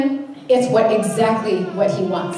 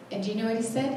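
usual speaking voice

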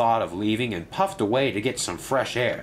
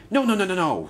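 usual speaking voice

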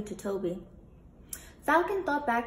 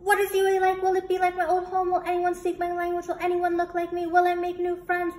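fast, higher and louder breathless questions; rattled off; 8 semitones higher, 7 dB louder, faster than usual. Acted. Nervous puppy rattling questions at the falcon, fast and loud. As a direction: fire the questions out without pausing. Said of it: "quickly rattled off all of the questions".

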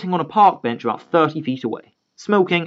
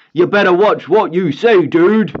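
threatening dad; 5 semitones higher, 5 dB louder, slower than usual. Acted.

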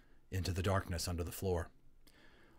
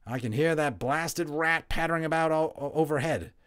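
higher, louder grumbling father; an annoyed dad complaining about the rat; father's voice; 8 semitones higher, 6 dB louder than usual. A narrator's reading. Father grumbles downstairs about the rat, louder. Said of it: "he heard his father's voice".

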